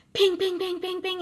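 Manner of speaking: high, bouncy pinging sounds; ping it like an alarm going off; mock signal ping. Medium imitating the spirit's signal, high playful pings.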